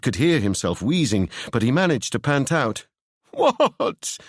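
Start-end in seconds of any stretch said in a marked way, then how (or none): 3.3-4.2 high, panting, tearful question. Acted. Seb panting out a tearful question to Ewan. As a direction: pant it out near tears. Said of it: "in a tearful voice".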